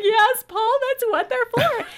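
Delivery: a very high, choked-up "yes, Paul"; retold tearful reply. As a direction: answer the butcher through tears, high and squeezed. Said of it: "And I started crying again. I'm like".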